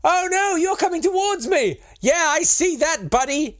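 very high, hammed-up American voice; inauthentic American accent. Comedian voicing two engineers about to collide, hammed-up inauthentic American accent. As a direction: play panicking train engineers in bad American.

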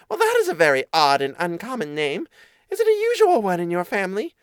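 high little mouse voice, climbing into the question; puzzled mouse. Acted. Mouse querying an odd family name, high and puzzled.

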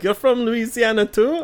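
hammed-up curious question; excited mock Franco. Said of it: "And he's like".

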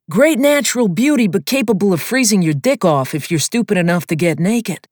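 high, loud crude pilot voice; joking Sweeney. A narrator's reading. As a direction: deliver the punchline with relish.

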